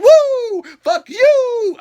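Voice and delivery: high, loud laughing woo; Ric Flair woo. Retold or imitated. Ric Flair cursing at Shane, high laughing woo. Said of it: "he's like".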